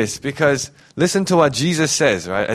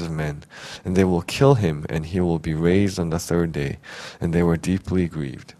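measured Bible reading, unhurried; scripture reading; 6 semitones lower than usual. Acted. Pastor reading scripture to the church, steady reading voice. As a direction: read the passage aloud to the congregation. Scripture read before the sermon.

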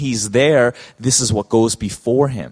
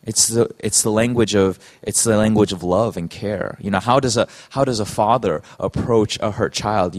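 ordinary talk, nothing marked

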